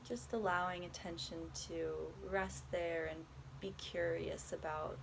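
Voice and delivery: calm, unhurried body scan guidance with long pauses; guided meditation. Acted. Guiding a listener through a body scan, soft meditation voice. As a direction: ease the listener gently from one body part to the next.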